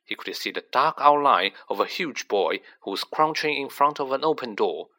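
plain reading voice

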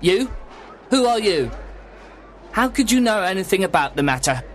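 high-pitched, suspicious little man; alarmed. A narrator's reading. Little man doubts stranger can help, alarmed. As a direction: demand it in nervous disbelief.

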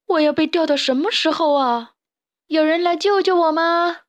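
high, loud plaintive wail; helpless, whiny shouting; calling for rescue. Acted. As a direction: complain loudly while stuck. Trapped character calls for rescue.

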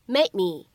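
"made me" with the d held unreleased; incomplete plosion. Shown as a demonstration. Teacher showing learners "made me" with an unreleased d. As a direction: stop the d of "made" and slide into "me". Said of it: "可以有一个不完全爆破的处理".

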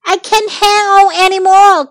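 high, loud cry of distress; desperate cry. A narrator's reading. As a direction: cry out as your grip fails. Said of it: "cried Tom".

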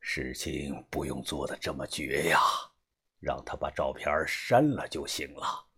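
put-on low, hushed older boss; old gang boss, troubled. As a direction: question gravely in a deep, soft voice. (把头低沉忧虑地追问)

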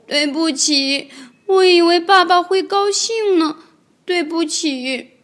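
高高的童声，慢慢地委屈认错; 像快哭的小孩道歉; 委屈道歉. Acted. 小恐龙被训后道歉. (委屈的童声道歉)

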